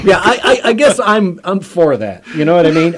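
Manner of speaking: laughing through the words; giggling, a bit flustered. Real, not acted. Laughing while answering.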